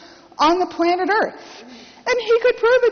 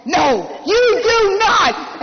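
slow, higher, louder shouted refusal; drawn-out, loud no; grandfather's shout; 6 semitones higher, 4 dB louder, much slower than usual. Retold or imitated. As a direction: bark each word like a stern old man. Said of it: "my grandfather's like".

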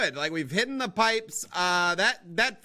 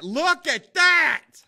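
high, loud, drawn-out excitement; amazed yell; 8 semitones higher, 6 dB louder, much slower than usual. Real, not acted. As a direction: stretch every word in loud amazement. Repeats it, amazed at his work.

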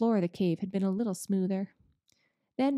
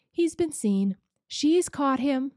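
higher, louder, slow frightened cry; 6 semitones higher, 5 dB louder, slower than usual. Acted. Lucy in the cave fearing Beaver is caught, frightened and slow. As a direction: dread that Beaver has been caught.

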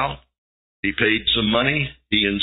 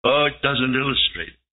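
ordinary talk, nothing marked

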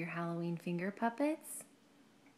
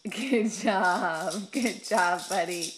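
excited praise; 9 dB louder, much slower than usual. Real, not acted. Parent praising baby after shaking it, loud and excited. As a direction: cheer the little one on loudly.

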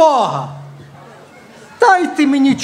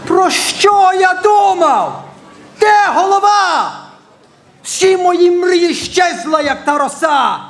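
theatrical exasperation; 5 semitones higher than usual. Acted. Acted despair over failed plans. (театральний розпач, високо й протяжно)

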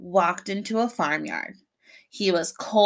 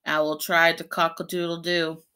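ordinary delivery, nothing marked

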